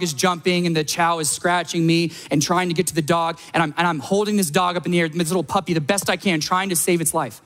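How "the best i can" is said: usual speaking voice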